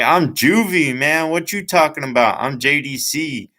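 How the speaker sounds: high-pitched mock-indignant retort; indignant comeback. Acted comeback in a confrontation.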